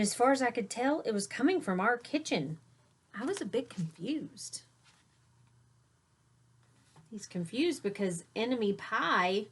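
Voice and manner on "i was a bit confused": whispered confusion; puzzled whisper. Acted. Child puzzled.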